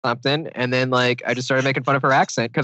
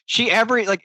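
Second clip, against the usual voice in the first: ordinary talk, nothing marked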